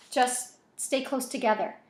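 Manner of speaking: plain reading voice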